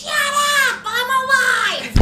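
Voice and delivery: high, loud, drawn-out mock scream; mom yelling back. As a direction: screech it like an indignant mom. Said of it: "she looks at me and she goes".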